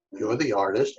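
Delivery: usual speaking voice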